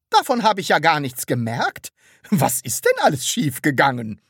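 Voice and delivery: curious. A narrator's reading. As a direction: press for an explanation with lively curiosity. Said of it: "fragte Frau Rotkohl neugierig". (neugierig nachhakend)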